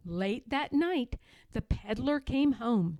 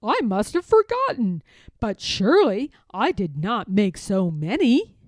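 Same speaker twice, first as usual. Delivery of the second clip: louder, puzzled peddler musing; mild puzzled peddler; 8 dB louder than usual. Acted. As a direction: wonder aloud, a little baffled. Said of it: "thought to himself".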